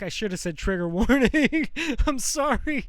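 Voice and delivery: nervous laughter breaking through. Real, not acted. Apologizing to viewers with a nervous laugh.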